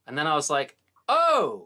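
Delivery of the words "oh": high, loud acted "oh"; sudden realisation. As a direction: let the penny drop out loud. Sudden realisation in retold misunderstanding.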